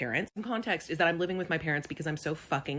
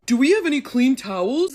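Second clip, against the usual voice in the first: a hammed-up higher, louder clueless husband; mock husband; 8 semitones higher, 9 dB louder than usual. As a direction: ask it all innocent. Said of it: "and you're going".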